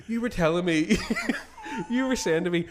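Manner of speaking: can barely get the words out for laughing; laughing retelling. Retold or imitated. Laughing as he retells what his wife told him. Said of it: "she goes to me".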